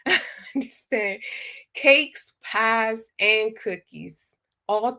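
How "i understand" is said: laughing. Real, not acted. Laughing at the honey buns answer.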